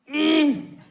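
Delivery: high, loud, short fake moan; a tiny, lame orgasm moan. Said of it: "this is how he thinks I achieve".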